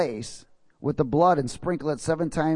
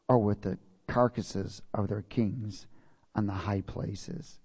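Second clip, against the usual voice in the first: God speaking solemnly; 6 semitones lower, 4 dB quieter than usual. Acted. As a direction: voice God's decree in a lowered, softer register with long pauses. Reads God's words from Ezekiel.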